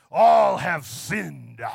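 loud, drawn-out mock preacher proclamation; imitated hellfire preacher. Mimicking preachers who love this verse, booming preacher voice. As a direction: thunder it like a condemning preacher. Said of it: "some preachers really like to preach this".

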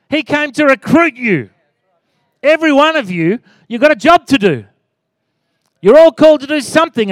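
high, loud exhorting preacher; full-force preaching. Real, not acted. Pastor telling the church they are recruited, impassioned preaching.

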